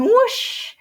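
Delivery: a loud rushing swoosh; sudden whoosh. A narrator's reading. Narrator springing the cheetah's dash on listeners, loud burst.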